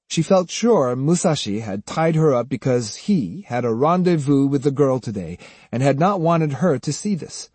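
plain reading voice

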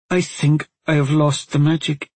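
high, distressed confession; near tears. A narrator's reading. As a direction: admit the loss, close to crying. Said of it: "so near to tears".

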